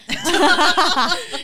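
loud high laughing burst; burst of laughter. Real, not acted. Laughs at funeral-home joke. (放声大笑)

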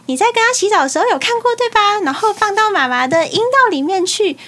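lightly acted mother teaching a small kid; imitated explaining mother. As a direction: mimic a matter-of-course parent lesson.

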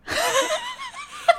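high falsetto laugh burst; burst of laughter. Real, not acted. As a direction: break into a squealing laugh. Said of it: "他讲话就是这么小声". (尖声爆笑)

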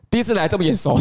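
can't keep a straight face retelling it; laughing. Real, not acted. Telling friends the embarrassing massage story, laughing. Retelling own awkward reply.

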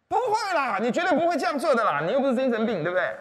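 high, loud, almost laughing no; emphatic loud denial. Real, not acted. Preacher to congregation, loud insistent denial. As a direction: shout down the absurd idea. Preacher answers his own question loudly.

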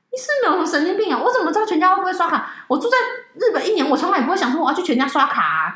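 imitating an exasperated sister's tirade; sister scolding. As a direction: rattle off the scolding like a furious sister. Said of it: "一直骂我说".

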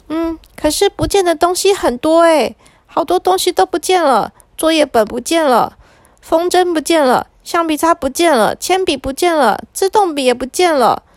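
a hint of a small girl complaining; lightly voiced little girl. Little girl listing lost things. (略带童声的小女孩)

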